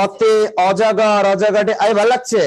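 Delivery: put-on slow, drawn-out Old Dhaka recitation; stretched Old Dhaka accent, primer style; Old Dhaka dialect. Shown as a demonstration. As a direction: mimic how Old Dhaka reads the primer. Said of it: "আর ওরাও কি বলে?".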